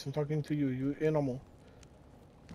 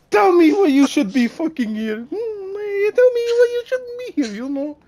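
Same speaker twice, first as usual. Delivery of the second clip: higher, louder raging rant; raging berating; 9 semitones higher, 8 dB louder than usual. Acted. Interrogator berating prisoner, furious shouting. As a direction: tear into him at full volume.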